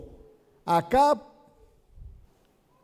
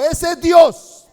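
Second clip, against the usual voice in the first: slow, loud, higher declaration; proclaiming shout; 5 semitones higher, 7 dB louder, slower than usual. Acted. Elijah challenging the prophets of Baal, slow loud shout. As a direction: thunder the verdict slowly.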